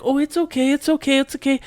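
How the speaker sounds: put-on very high, soothing child voice; comforting little girl. Daughter comforting the crying girl, high and soothing. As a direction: soothe her like a kind little sister.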